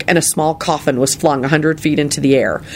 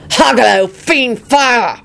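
high, forceful battle cry; shouted spell; 9 semitones higher, 4 dB louder, much slower than usual. A narrator's reading. Lupin sets fire on four attackers, shouting. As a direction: roar the incantation out.

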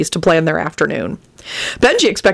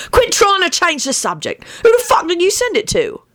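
demanding; 13 semitones higher than usual. A narrator's reading. Demanding character.

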